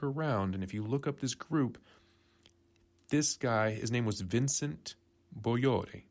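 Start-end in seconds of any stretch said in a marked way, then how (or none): 5.4-6.0 Bolloré said the Italian way, like "bo-yo-REE" rather than French; Bolloré in a mock Italian style, not French; Italian-sounding Bolloré. Shown as a demonstration. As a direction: give Bolloré an Italian ring, stress on the end. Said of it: "I'm saying it way Italian".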